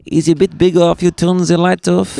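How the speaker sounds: punchline redone in a put-on German accent. Shown as a demonstration. Comedian fixes his punchline with the German accent. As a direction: add the German accent he forgot. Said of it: "I forgot to do the accent".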